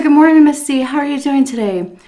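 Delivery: put-on cheerful caregiver greeting an elderly client; cheery greeting. Caregiver greeting Miss C on her bench, bright and cheerful. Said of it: "I said".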